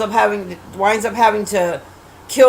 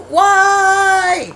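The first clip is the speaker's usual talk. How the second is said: high, drawn-out wailing "why"; retold scream; 8 semitones higher than usual. Viewer screaming at the movie entire time, mock wail. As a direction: cry it out in mock despair. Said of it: "I screamed the entire time".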